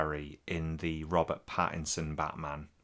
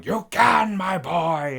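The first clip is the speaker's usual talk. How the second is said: imitating Peter O'Toole; 13 semitones higher, 11 dB louder, much slower than usual. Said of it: "he keeps going".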